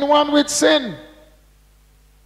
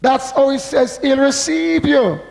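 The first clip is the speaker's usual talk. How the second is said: ordinary talk, nothing marked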